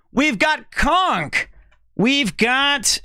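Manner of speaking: high, very slow announcement; announcer style. Real, not acted. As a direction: draw the name out. Announcing the mod.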